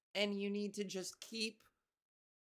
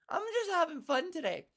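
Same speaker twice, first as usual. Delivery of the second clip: high, louder and playful; 8 semitones higher, 4 dB louder than usual. Real, not acted. Playing with her voice on the podcast, lifted high. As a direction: lift it up brightly, playing around. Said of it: "I'm playing around".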